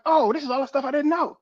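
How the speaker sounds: high-pitched acted-out discovery; sudden realization. Would-be pilot reading the study book, surprised realization. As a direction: replay the moment it all clicks. Acts out realizing what he lacked.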